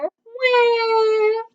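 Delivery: high crying wail; a toddler bear's storybook cry; baby bear wail. A narrator's reading. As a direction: let out a little wailing sob.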